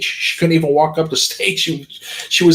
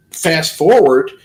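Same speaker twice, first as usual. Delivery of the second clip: ordinary delivery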